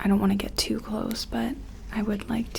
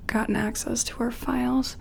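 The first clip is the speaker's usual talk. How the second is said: normal talking voice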